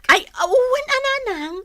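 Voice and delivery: very high, tearful-sounding protest. Acted. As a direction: squeal a bashful refusal. Daughter-in-law modestly declines offer.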